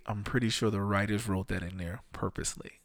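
ordinary talk, nothing marked

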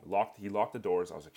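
usual speaking voice

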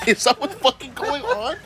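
high, drawn-out question through laughter; laughing disbelief. Real, not acted. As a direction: ask it slowly while cracking up. Laughs while asking mocking question.

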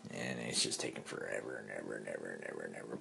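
low, very soft, hurried drone; droning on endlessly. Retold or imitated. Storyteller stuck waiting in line, low endless drone. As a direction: let the repeats run on under your breath.

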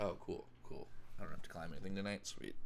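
mumbled mock-casual relief; deadpan Orange Cassidy. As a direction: shrug it off like nothing matters. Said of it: "Orange Cassidy's like".

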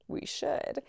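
soft, high, near-whispered; hushed agreement. Retold or imitated. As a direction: repeat it softly, as if it just dawned. Repeats it as quiet realisation.

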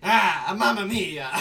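loud hammed-up Italian exclamation; Italian accent. Mario Italian accent bit.

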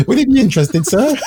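high, polite telesales voice through giggles. Shown as a demonstration. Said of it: "I'll do the other voice".

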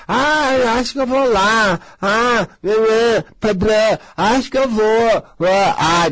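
a hesitant woman mocked, drawn out; dithering seeker. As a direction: mimic a wishy-washy client who can't decide. Mimics hesitant visitor.

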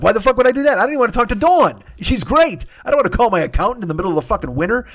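loud, high, fast outburst; shouting it out, fed up; exasperated rant. Real, not acted. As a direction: blow up in frustration at the whole mess. Frustrated rant about calling accountant.

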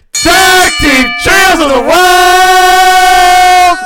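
announcer building to a roaring shout; slow, drawn-out arena introduction; ringmaster. Acted. Wrestling-style show intro.

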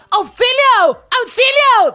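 high shrieking cry for help; screaming for help. Retold or imitated. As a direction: shriek it out, panicked. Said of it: "empieza a gritar una señora".

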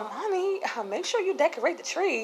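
a hint of a small boy coaxing; lightly voiced son. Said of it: "he's like".

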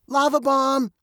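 bright call. A narrator's reading. Veronica calls from the passenger seat, bright warning call. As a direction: call it out brightly, pitched well up. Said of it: "Veronica called brightly".

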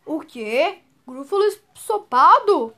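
loud, slow alarmed cry; panicked. Acted. Gruffalo hears he is the mouse's favourite dish, panicked. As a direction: recoil in horror. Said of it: "fugiu apavorado".

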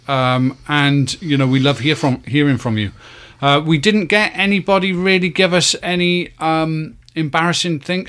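ordinary delivery

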